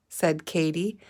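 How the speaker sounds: ordinary delivery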